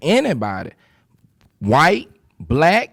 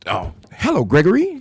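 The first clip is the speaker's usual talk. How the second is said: mock proper greeting; 5 semitones higher than usual.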